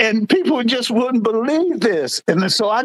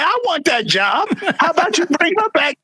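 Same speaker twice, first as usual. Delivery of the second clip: indignant demand; 9 semitones higher than usual. Retold or imitated. Retold indignant demand to supervisor.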